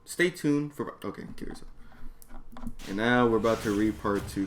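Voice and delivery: plain reading voice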